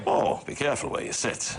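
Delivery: lightly voiced broadcaster impression; put-on celebrity voice, played for laughs; Terry Wogan. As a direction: do a famous presenter on request. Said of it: "Do Teddy Wogan, do Teddy Wogan".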